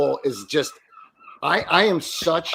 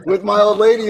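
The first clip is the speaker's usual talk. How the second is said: ordinary talk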